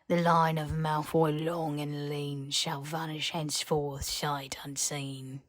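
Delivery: slow, eerie chanted curse verse; raspy elf recitation. A narrator's reading. Elf Old Burn reciting the hag's curse, slow and raspy. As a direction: intone it like an ancient house-elf prophecy. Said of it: "reciting in a raspy voice".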